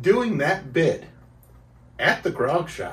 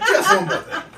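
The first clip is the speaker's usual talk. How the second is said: high, laughing detective parody; hammed-up detective catchphrase, pitched way up; Columbo; 14 semitones higher than usual. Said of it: "the title of this episode".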